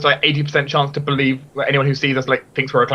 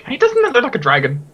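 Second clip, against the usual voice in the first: curious onlooker; 9 semitones higher than usual. Pretend. Voicing people looking up.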